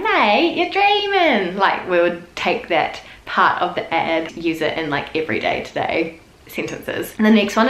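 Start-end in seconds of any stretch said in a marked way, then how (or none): 0.0-1.6 high, slow scoffing "Mate, you're dreaming"; mock "you're dreaming". Brushing off a mate's request, high and scoffing.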